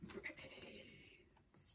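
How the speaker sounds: breathy whispered undead moan; whispered zombie moan. Acted. Zombies shamble off moaning.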